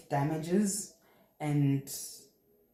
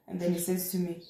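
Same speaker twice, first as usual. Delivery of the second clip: ordinary talk, nothing marked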